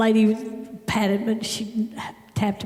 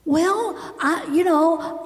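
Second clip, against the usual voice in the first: retold hesitant excuse; 7 semitones higher, much slower than usual.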